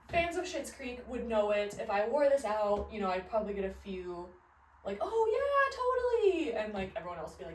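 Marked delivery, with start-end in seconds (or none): 5.0-6.5 high, loud, drawn-out squeal of agreement; mock fan recognition. Fan recognising the costume, mock excited squeal. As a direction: play an over-eager fan, pitched way up.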